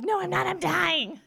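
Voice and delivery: high, loud, frantic insistence; panicked denial. Retold or imitated. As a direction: protest like you're sure you're dying. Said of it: "I was like".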